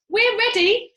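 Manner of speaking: loud high cheer; eager chorus. A narrator's reading. Monkeys calling back ready, loud and high.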